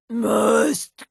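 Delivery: slow, otherworldly voice ending in a shouted cry; eerie slow menace breaking into a shout; possessed killer. Acted. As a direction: drag out the hunger to kill. Possessed creature growling.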